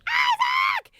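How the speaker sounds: piercingly high, loud shout; screaming. Retold or imitated. Friend screaming at the guard, high mock shriek. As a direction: imitate a friend shrieking a name. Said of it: "my friend's screaming too".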